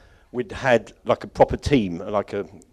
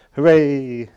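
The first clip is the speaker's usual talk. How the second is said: higher, drawn-out little hooray; mock cheer; 4 semitones higher, 4 dB louder than usual. Speaker jokes that it's almost finished, mock cheering. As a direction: give a playful pretend cheer.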